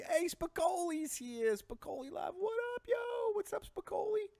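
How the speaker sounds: very high, whooping hello; hyped greeting. Acted. Host hailing Spicoli on the show, high whooped greeting. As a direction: squeal an excited stoner-dude shout-out.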